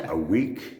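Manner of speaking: a week, blurted through a laugh; laughing disbelief. Retold or imitated. Told what she earns, laughing in disbelief. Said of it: "And I went".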